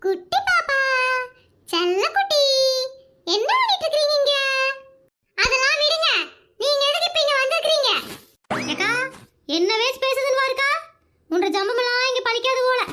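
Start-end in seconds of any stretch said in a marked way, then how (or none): 0.0-4.3 put-on cooing to a child. Coaxing the neighbour's small child. 5.4-7.9 put-on high child's voice, brushing off flattery; be a cheeky small girl demanding answers; little granddaughter. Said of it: "அவளோட பேத்தி".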